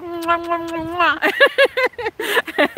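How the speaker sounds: high smacking air kisses; blown kisses. Real, not acted. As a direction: blow kisses to the chat. Blowing kisses to viewers.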